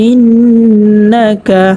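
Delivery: ইন্না with a long nasal ন্ন, very slow; ghunnah recitation. Shown as a demonstration. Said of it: "গুন্না করিয়া পড়তে হবে". (ইন্না-তে ন্ন নাকে টেনে)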